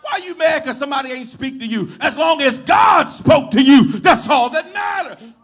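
loud, emphatic sermon shout; full-force preaching. Real, not acted. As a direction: preach it hard to the whole church.

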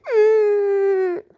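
put-on high wailing little kid, slow; crying child. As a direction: sob it out like a hurt toddler. Hurt child wails at mother.

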